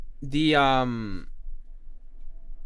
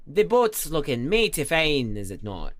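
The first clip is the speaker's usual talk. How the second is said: put-on Scottish accent. Streamer cheering on the wee lad's boat, in a Scottish accent. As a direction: praise it like a proud Scot. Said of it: "That was [name]'s Scottish accent".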